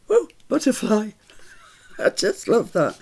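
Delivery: usual speaking voice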